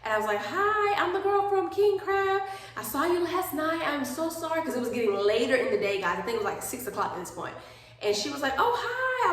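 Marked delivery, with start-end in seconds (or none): 0.4-4.6 much higher, slow, sugary phone voice; sweet polite voice. Retold or imitated. Retold polite phone call to lady. 8.6-9.3 surprised greeting. Retold or imitated. Lady answers the phone.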